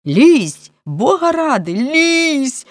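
high-pitched, exasperated order; explosive outburst. A narrator's reading. Police chief exploding at a man who refuses, high outburst. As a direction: burst out, pitch leaping up. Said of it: "вибухнув начальник поліції".

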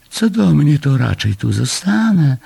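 high, yawny cat voice; yawning. A narrator's reading. Cat declining to leave her sunny spot, yawning. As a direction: speak as if through a yawn. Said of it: "ziewając".

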